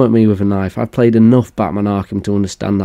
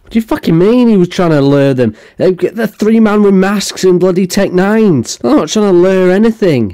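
incredulous rant; 7 semitones higher than usual. Real, not acted. Outraged at the narrator's claim.

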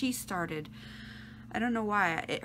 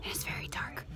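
whispering; 6 semitones lower, 7 dB quieter than usual. Real, not acted. Whispering in movie theater.

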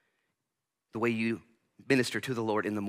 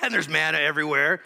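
mock amazement; 6 semitones higher, 7 dB louder than usual. Acted amazement at manna.

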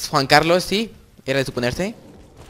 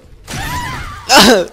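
1 high cackling laugh; 9 dB louder than usual. Real, not acted. Big win moment, gamer laughs.

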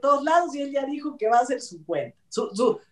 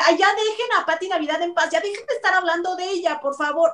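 higher, quicker mock complaint; a light mocking plea, a bit higher; mocking whiny viewer; 6 semitones higher than usual. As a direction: whine it like an annoyed fan. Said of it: "nos escribieron para decir".

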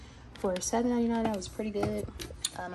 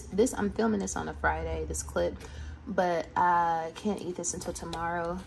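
usual speaking voice

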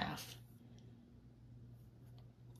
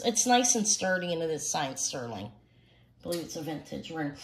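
normal talking voice

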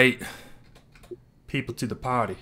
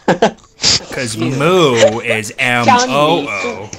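very slow, letter by letter; dragged out spelling taunt; slow spelled-out mockery; 7 dB louder, much slower than usual. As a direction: spell it out painfully slowly to mock him. Mocking spelling.

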